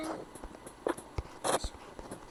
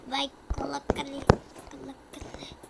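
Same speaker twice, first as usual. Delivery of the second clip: whispering; faster than usual. Real, not acted. Sussurro rápido.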